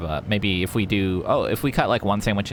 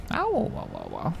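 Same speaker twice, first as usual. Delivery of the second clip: sudden high startled whoa; 10 semitones higher, 5 dB quieter than usual. Real, not acted. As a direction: caught off guard, whoa. Startled in game drop.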